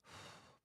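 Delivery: slow breath out to settle yourself; a long soft sigh, letting go; shown calming sigh. Shown as a demonstration. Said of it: "I feel like falling apart right now".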